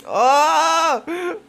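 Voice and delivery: sudden high yelp of shock; startled high cry. Real, not acted. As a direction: gasp out loud, startled. Startled by the story.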